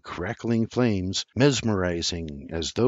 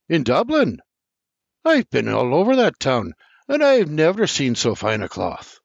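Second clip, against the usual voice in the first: louder, raised surprise at the town; uncle's surprised reply, voice up; 5 semitones higher, 4 dB louder than usual. A narrator's reading. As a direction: doubt it, then marvel. Uncle surprised about the cloth.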